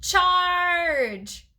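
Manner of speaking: high, drawn-out battle cry; yelling. A narrator's reading. Hamster running at the food bag, yelling. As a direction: yell it long like leading an attack.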